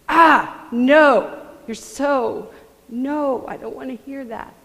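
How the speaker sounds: mock a teen blocking bad news; loud protest. Teens cutting off their mom, a loud whiny protest. Kids refusing the mom's warning.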